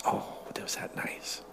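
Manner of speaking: hushed awed whisper. A narrator's reading. Narrator marvels at city lights, whispering. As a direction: breathe it in wonder.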